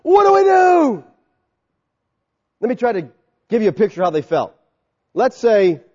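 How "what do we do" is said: high, loud panicked question; alarmed crowd. Retold or imitated. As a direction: sound caught out and scared. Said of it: "and so they're like".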